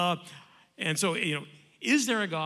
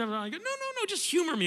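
much higher, coaxing no no no; insistent; 12 semitones higher than usual. Retold or imitated. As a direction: coax him to play along. Insisting on the hypothetical.

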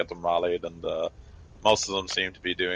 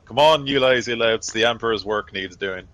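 loud, raised-pitch sergeant's wake-up call; rousing the troops; 4 semitones higher, 7 dB louder than usual. Acted. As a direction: roust the lazy soldiers out of bed. Rousing lazy soldiers from sleep.

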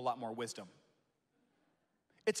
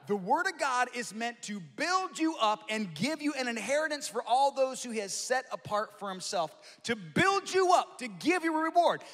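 much higher and louder, ringing out; full-force preaching; 8 semitones higher, 8 dB louder than usual. Acted. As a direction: lift the room with it.

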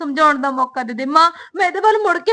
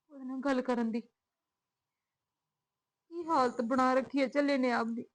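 much softer, talking to herself; inner worry; 12 dB quieter than usual. Acted. Daughter worrying alone how to meet Sunny, quietly. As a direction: murmur your doubts alone. Daughter's private worried thoughts.

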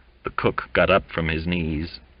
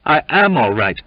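loud, raised, eager assurance; eager, higher-pitched protest; 7 semitones higher, 6 dB louder than usual. A narrator's reading. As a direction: insist eagerly that all is well. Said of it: "said Waite with eagerness".

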